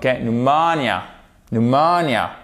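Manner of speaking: "Pneumonia" as "nu-MO-nia"; stress on MO. Shown as a demonstration. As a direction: lean on the second syllable of "Pneumonia". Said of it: "on fait bien l'accent tonique".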